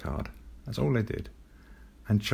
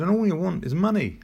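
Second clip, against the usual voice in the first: retold cross mum; 8 semitones higher than usual. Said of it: "She said".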